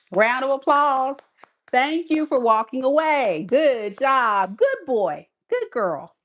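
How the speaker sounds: high, gushing sing-song thanks; sarcastic mock praise. To Aquarius, mocking gratitude for the ex walking away, high sarcastic gushing. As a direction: gush over the ex leaving, dripping sarcasm. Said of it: "I'm being sarcastic".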